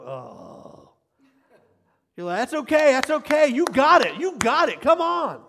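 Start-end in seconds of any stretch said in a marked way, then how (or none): 0.0-0.9 low, soft drawn-out "oh"; disappointed crowd groan. Retold or imitated. People watching a player's mistake, groaning in disappointment. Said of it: "hear some people going". 2.4-5.5 high, loud shouts of encouragement; cheering parent. Acted. Parent to a player who slipped up, shouting encouragement. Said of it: "I'm cheering for 'em".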